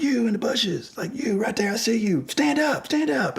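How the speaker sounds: high, commanding call-out to a stranger; big voice. Retold or imitated. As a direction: project it like you own the street. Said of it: "I'm trying to use, like, my big voice".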